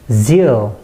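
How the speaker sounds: loud, falling "zeal"; stressed syllable. Shown as a demonstration. Teacher isolates the stressed syllable, loud "zeal". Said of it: "여기 부분에 강세가 있습니다".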